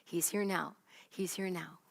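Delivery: murmured softly to oneself; soft, inward reassurance; quiet repeated reminder to oneself through the day; quiet self-reminder. Acted. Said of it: "you remind yourself".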